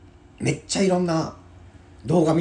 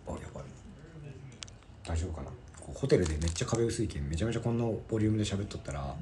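quiet, self-conscious aside through thin hotel walls; lowered voice; 9 dB quieter than usual. Real, not acted. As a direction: keep it down so the neighbours don't hear. Worried voice carries through thin walls.

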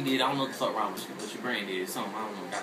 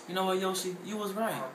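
mock girlfriend voice; 7 semitones higher, 4 dB quieter than usual. Friends admitting Yoshi was right.

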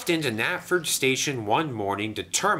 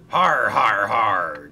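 loud hearty har har; pirate laugh; 9 dB louder, slower than usual. A narrator's reading. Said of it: "chuckled Sailor John".

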